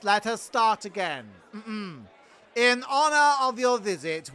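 ordinary talk, nothing marked

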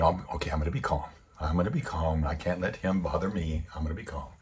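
low, hushed, talking herself down; steady yourself quietly, pitched low; self-calming. Retold or imitated. Driver telling herself to stay calm, low and soft.

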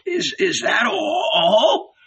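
very high, slow, tearful stammer; trembling voice. A narrator's reading. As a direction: quaver pleadingly, far up in pitch. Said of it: "his voice trembling".

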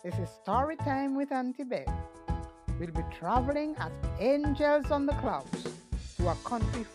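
put-on gentle host reading to children; storytime voice. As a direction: invite little kids along, gently. Storytime host intro with music.

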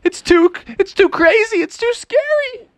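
high, panicky squeal; frightened Froggy. Acted. Froggy begs Dad to leave, frightened. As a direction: plead to flee in a hammed-up fright. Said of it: "And Froggy's like".

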